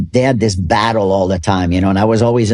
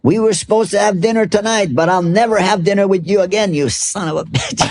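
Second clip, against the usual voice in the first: pitched up high, a hammed-up furious parting shot; mock angry yell; 9 semitones higher than usual. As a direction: yell it back like a sore loser, mostly for laughs. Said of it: "he yells back and he says".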